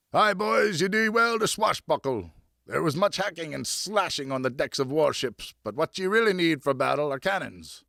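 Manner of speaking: put-on a grizzled seafarer egging the boys on; crusty enthusiastic shout. A narrator's reading. Old sailor shouting advice to the boys, crusty and eager. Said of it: "shouted a crusty but enthusiastic voice".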